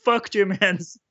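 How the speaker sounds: high, puppet-frog voice all through; Kermit voice. Acted. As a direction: keep the Kermit voice going. Talking in Kermit voice.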